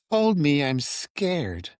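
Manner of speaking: high, whiny pretend fright, a hammed-up cry to be held; playing the frightened guy begging his girlfriend to hold him, high and whiny; mock scared plea.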